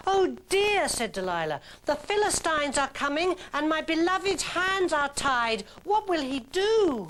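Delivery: high, hammed-up distressed damsel; mock alarm. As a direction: overplay the helpless lady.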